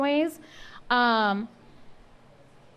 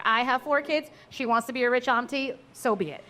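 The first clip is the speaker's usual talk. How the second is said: ordinary delivery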